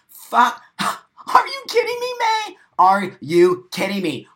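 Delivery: gasp, then a very high, loud "kidding me"; shocked outburst. Real, not acted. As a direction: explode in disbelief. Outraged reaction to viewer comment.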